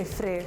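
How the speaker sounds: frigg with a long ee and softened ending; Icelandic pronunciation. Shown as a demonstration. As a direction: say frigg as "free" with a light ending. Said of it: "In Iceland".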